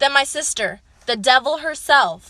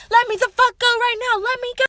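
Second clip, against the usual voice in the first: high-pitched mock demand; raised-pitch acted protest; retold angry protest; 10 semitones higher than usual. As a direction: mimic a pinned sister struggling free. Pinned sister's acted protest.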